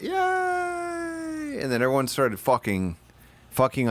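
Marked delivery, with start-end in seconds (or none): 0.0-1.6 high squeaky crowd cheer; mock cheer. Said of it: "Everyone went".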